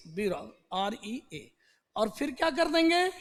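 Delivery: usual speaking voice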